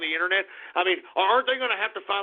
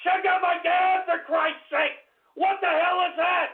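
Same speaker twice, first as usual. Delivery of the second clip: outraged shouting; 7 semitones higher than usual. Acted. Outraged at a meme.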